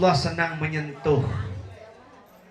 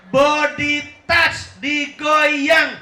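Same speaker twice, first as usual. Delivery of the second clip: louder and higher, body touch stretched slowly; loud, drawn-out demo; 9 semitones higher, 6 dB louder, much slower than usual. Shown as a demonstration. Preacher showing the congregation how to shake hands, loud and slow. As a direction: call out the lesson with the handshake. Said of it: "Salaman yang bener tuh kayak gini nih".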